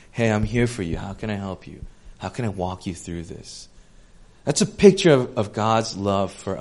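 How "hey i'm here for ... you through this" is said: soft, much quieter and lower, gentle reassurance; tender comfort. Retold or imitated. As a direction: kneel to a child and comfort him softly. Adult comforting child, softly.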